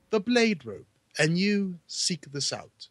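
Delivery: slow, drawn-out British impression; mock British wrestler voice, slowly; Regal. Shown as a demonstration. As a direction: put on a British accent and stretch it out. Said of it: "you did a Regal impression".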